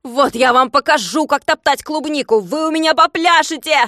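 loud, high threatening yell; angry threat. Acted. Klops threatening the trampling workers, angry threat. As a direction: threaten them with punishment.

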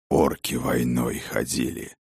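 low, slow angry hiss; whisper yelling. Acted. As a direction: seethe it under the breath. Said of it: "гневно прошипел Сфайрат".

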